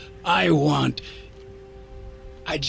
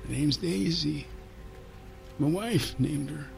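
very soft, hushed brogue; dreamy afterthought; 3 semitones higher, 12 dB quieter than usual. A narrator's reading. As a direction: add it quietly, lost in memory. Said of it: "before he added".

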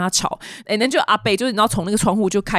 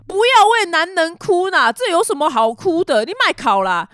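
imitated Taiwanese-speaking uncle, pitched up; neighbour uncle; 8 semitones higher than usual. Neighbour uncle tells her not to cry over men, high-pitched imitation. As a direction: console her like a chatty local uncle, higher voice. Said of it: "然后他就说".

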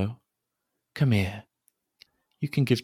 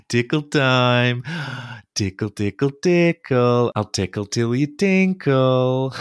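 louder, higher giggly excitement; playful tickling; 4 semitones higher, 6 dB louder than usual. Acted. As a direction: giggle and squeal through the tickling. Tickle game, laughing.

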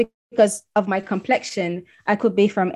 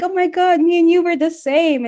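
excited gush; 9 semitones higher than usual. Retold or imitated. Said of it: "and I'm all excited".

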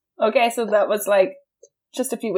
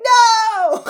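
high, loud overreacting cry; dramatic no; 13 semitones higher, 5 dB louder than usual. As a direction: wail it like a tragedy. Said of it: "I'm like".

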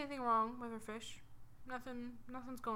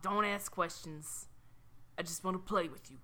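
raspy New Yorker; 3 semitones lower than usual. Acted. Fish dodging the girl's questions, raspy New York voice. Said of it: "They all sound like raspy New Yorkers".

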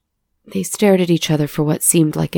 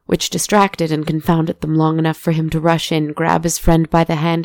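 ordinary delivery

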